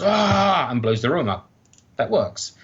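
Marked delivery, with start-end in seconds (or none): 0.0-0.7 a high, loud imitated yell "Ah!"; mock Vader yell. Describing the Spanish dub to his cohost, a loud imitated yell. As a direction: yell it like Vader blowing the room up. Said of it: "He comes and goes".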